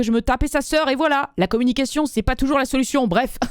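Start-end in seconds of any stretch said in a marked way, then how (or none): none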